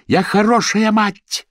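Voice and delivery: high, slow, furious shout; yelling. A narrator's reading. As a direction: yell it out, stretching each word. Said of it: "заорала Молли".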